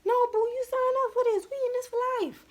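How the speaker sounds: squeaky mock wife scolding her husband; mock spouse voice. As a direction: play a clingy partner insisting it's for life. Voicing a spouse who won't let go.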